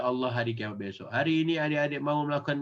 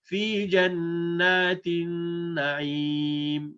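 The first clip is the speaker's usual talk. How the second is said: slow chanted "fi jannatin na'im"; Quran recitation; 3 semitones higher, much slower than usual. Shown as a demonstration. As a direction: recite it like tilawah. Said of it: "ayat sebelas". (lantunan ayat pelan)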